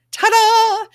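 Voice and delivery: high, loud showy ta-da; cheerful announcer flourish; big reveal. Real, not acted. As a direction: present it like a magic trick. Reveals her shared screen.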